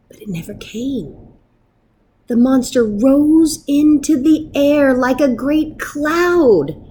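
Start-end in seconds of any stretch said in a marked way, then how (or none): 0.1-1.2 whisper. A narrator's reading. Suspense in narration.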